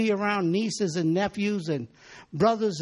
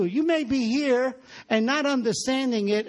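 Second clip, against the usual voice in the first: ordinary delivery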